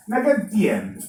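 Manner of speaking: ordinary talk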